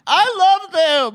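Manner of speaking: high, loud, slow gush of love. Real, not acted. Host gushing about the couple on the show, high excited squeal. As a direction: squeal it out, overcome with delight. Gushing over the proposal scene.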